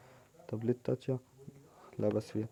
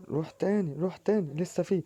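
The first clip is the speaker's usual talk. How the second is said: excited urging; 8 semitones higher than usual. Real, not acted. Urging player on.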